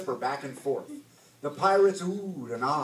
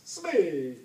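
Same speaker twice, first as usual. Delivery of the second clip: summoning call. A narrator's reading. Pirate captain calls his mate.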